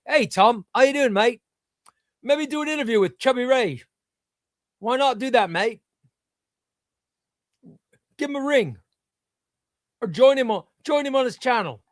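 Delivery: high-pitched mock Australian; hammed-up Aussie voice; Australian accent. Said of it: "don't you live in Australia?".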